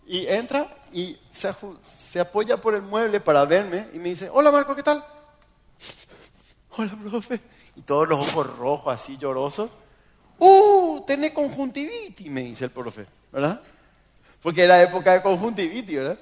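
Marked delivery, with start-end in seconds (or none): 4.3-5.0 saludo agudo y fuerte, imitado; profesor saludando animado. Retold or imitated. Profesor saluda, voz actuada. 6.8-7.4 vocecita aguda de quien estaba llorando; saludo lloroso imitado. Retold or imitated. Él responde llorando al profesor. 10.4-12.3 exclamación muy aguda y fuerte; profesor alarmado, imitado. Retold or imitated. Profesor exclama al verle ojos rojos.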